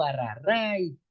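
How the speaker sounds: "arrarray" said slowly as a local Andean cry at touching something hot; drawn-out highland "arrarray" as if burned; Andean exclamation. Shown as a demonstration. Teacher shows his highland dialect word, slow Andean cry. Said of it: "Ese es mi dialecto".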